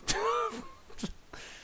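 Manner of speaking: high airy giggle; a quick unvoiced chuckle; breathy whispered laugh. Real, not acted. Laughing at own misspelling.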